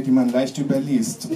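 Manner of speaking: normal talking voice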